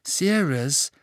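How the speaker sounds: high, anxious questioning; worried. A narrator's reading. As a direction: ask with concern, pitch climbing. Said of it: "fragte Hermine besorgt".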